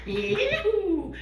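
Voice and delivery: high, loud party whoop; festive whoop. Acted. Host kicking off the wild party, whooping. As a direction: let out a wild party cheer.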